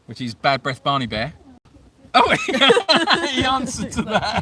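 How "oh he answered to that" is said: high-pitched, laughing through the words. Real, not acted. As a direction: crack up in real amusement while talking. Dog answers to his nickname.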